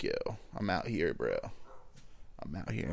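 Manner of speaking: dog voiced as a low quiet bro; voicing the dog. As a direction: give the scared dog a low mumbly dude voice. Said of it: "He's like".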